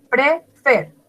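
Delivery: "prefer" stressed on the final "fer"; stress on last syllable. Shown as a demonstration. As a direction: land the weight of "prefer" on "fer". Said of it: "la última sílaba está acentuada". ("prefer" acentuado en la última sílaba, "fer")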